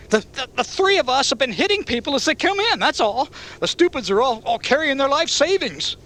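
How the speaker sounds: very high, loud, stammering panic; panicked biker. A narrator's reading. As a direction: gabble it out in fear. Terrified biker pleads at gunpoint.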